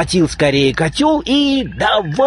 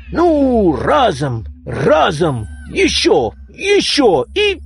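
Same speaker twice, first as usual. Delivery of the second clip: straining; 6 semitones higher, slower than usual. Acted. Straining to lift cauldron.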